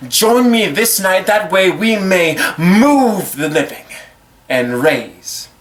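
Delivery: slow, high rallying cry, dropping at the end; drawn-out impassioned finish; rousing climax. Acted. Rallying climax of spoken-word piece.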